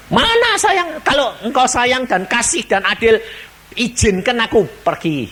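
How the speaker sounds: high indignant demand; sinner protesting. Acted. Sinner demands God let him go to heaven, high and indignant. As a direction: throw the question back up high. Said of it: "dia teriak-teriak begini".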